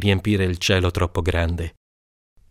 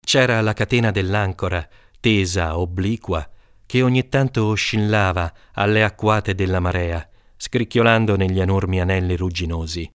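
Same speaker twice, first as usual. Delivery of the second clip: ordinary delivery, nothing marked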